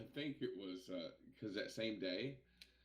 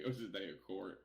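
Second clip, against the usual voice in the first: ordinary talk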